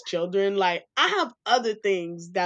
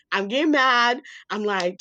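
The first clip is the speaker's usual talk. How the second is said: ordinary talk, nothing marked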